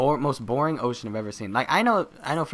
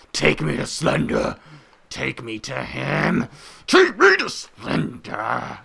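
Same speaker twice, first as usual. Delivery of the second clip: hammy commanding voice; 3 semitones higher, 5 dB louder, much slower than usual. Pretend. Player demanding Slender's lair, slow hammed-up bellow. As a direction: drag out each demand to a strained yell.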